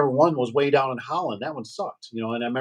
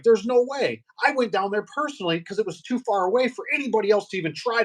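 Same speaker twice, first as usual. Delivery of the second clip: higher, worked-up insistence; mock indignant protest; 8 semitones higher than usual. Retold tech protesting his install, higher and worked up. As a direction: insist it can't be your fault. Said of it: "You know, like".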